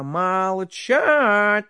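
loud, high, furious royal shout; a tsar bellowing in rage at his servant; angry tsar shouting. Acted. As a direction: roar at him like an outraged tsar. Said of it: "закричал к нему со гневом".

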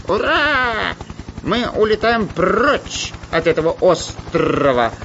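high parrot squawk with rolled Rs; joyful parrot cry. A narrator's reading. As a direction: crow happily like a parrot, drawing words out. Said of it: "радостно закричал попугай Кутя-Плютя".

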